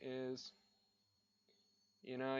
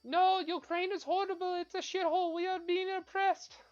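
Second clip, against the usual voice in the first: much higher, girlish mock voice; mock pretty girl; 4 dB louder than usual. Comic mocking the girl in the Ukraine video, high girlish voice. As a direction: squeak it out like a pretend-earnest girl in a video. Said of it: "pretty girl go, talking in really good English going".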